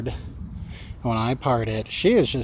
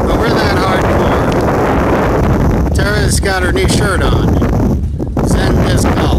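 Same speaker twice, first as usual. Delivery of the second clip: loud, raised voice against the wind; shouting over wind; 5 semitones higher, 5 dB louder than usual. Real, not acted. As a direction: shout to be heard outdoors. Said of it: "can't even hear me over the wind".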